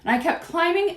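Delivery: ordinary delivery, nothing marked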